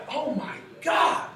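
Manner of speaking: slow high Oh my God; drawn-out amazed shout. Retold or imitated. Imitating trainees reacting to a big sale with an amazed shout. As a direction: gasp it out slowly and loud. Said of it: "I hear some of you like".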